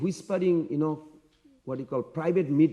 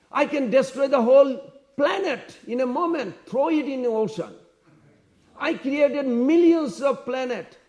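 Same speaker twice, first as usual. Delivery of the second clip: high-pitched, forceful brag; defiant boasting; 8 semitones higher, 4 dB louder than usual. Retold or imitated. Young prince boasts of his power to the mother, defiant and high. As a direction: proclaim your huge power, voice raised high.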